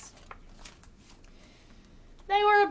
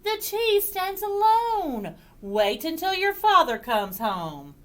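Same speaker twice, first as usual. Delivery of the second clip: high, slow pronouncement easing down; motherly insistence, high then settling; mother forbidding; 9 semitones higher, 4 dB louder than usual. Acted. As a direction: lay down the rule to a child. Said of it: "said Mother".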